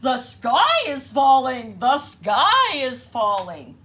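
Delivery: very loud screaming. A narrator's reading. Foxy Loxy screaming as he leaps from the wreckage. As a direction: scream it as you flee the wreck.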